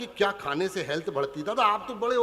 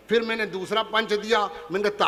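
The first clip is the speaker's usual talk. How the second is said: ordinary talk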